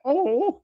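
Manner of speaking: high, loud mock wail; drawn-out high "oh" of dismay; imitated wounded "oh". Imitating the man being dragged away, high wail. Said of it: "when he makes that".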